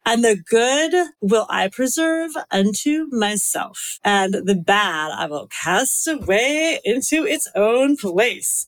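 put-on Valley girl accent ending like a question; Valley girl uptalk. Shown as a demonstration. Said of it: "do everything with a question at the end".

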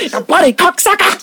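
high-pitched botched Aussie sliding into Cockney; Cockney-like accent. Acted. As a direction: curse in a put-on Cockney twang. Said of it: "that was more like a Cockney accent".

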